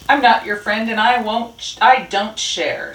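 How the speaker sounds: in a huff. A narrator's reading. Rat turns down Pigeon's muffin, in a huff. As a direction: refuse indignantly as a grumpy Rat. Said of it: "said Rat in a huff".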